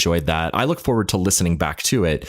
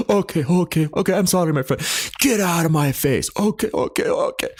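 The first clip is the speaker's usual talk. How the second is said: put-on high, placating thick Armenian accent; 11 semitones higher than usual. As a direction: brush off the cop in a heavy Armenian accent. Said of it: "lays on the thickest accent you've ever had".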